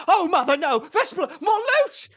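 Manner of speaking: high, loud alarmed shout; panicked cry. Acted. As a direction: shriek as the lute falls. Lute thrown from balcony.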